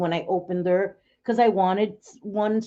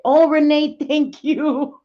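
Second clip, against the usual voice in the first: excited thanks; 6 semitones higher, 6 dB louder, slower than usual. Real, not acted. Thanking a gifting viewer.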